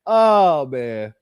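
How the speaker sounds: ordinary delivery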